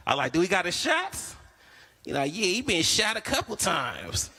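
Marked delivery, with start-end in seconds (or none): 0.3-1.3 higher doubtful question rising; skeptical question. Retold or imitated. Comic asking the seller about shots, doubtful. 2.3-4.3 higher, slow, deadpan; mock seller. Seller proudly answering the buyer, slow deadpan.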